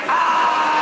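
luide, hoge kreet van woede; boze kreet. Acted. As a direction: schreeuw het uit van colère. Boze jongen schreeuwt. (boze jongen schreeuwt woedend)

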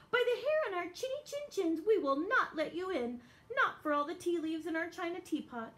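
fast, high defiant wolves; high little wolves voice. Acted. Wolves defying the pig.

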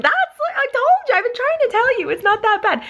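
high, squeaky retold protest; acted high-pitched insistence; exasperated mom. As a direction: plead it in a high voice. Retold exasperated mom, trying not to laugh.

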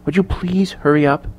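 quiet, pressing whisper-like voice; hushed urging. A narrator's reading. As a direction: urge them on under your breath. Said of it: "Mary whispered in hushed tones".

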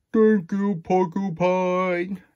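slow, loud, high bear thanks; grateful bear voice. Acted. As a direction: draw out a big warm thanks.

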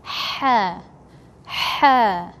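ha, breathy from mid-throat. Shown as a demonstration. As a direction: model the throat ha. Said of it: "in the middle of the throat is ha".

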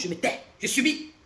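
ordinary delivery

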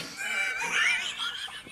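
shrieking with laughter; high shrieking laugh. Real, not acted. Laughing at the story.

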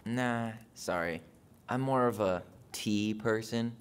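slow, drawn-out joke setup; deliberately slow pun delivery; slow deadpan. Acted. Setting up pun slowly.

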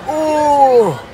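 high, sudden mock yelp; startled yelp. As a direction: jump at the surprise. Startled cry.

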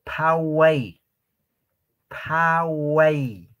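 phaway with a puffed ph, drawn out; aspirated ph. Shown as a demonstration. Teacher modelling the Quechua verb for run. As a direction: breathe out hard on the ph of phaway. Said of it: "el verbo correr, en quechua".

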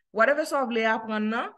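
usual speaking voice

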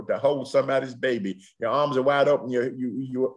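ordinary delivery

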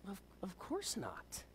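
slow, barely voiced whisper; shocked whisper. Wife answers husband's divorce question, acted slow whisper. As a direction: whisper it haltingly, as an acted reply.

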